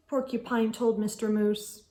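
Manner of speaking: usual speaking voice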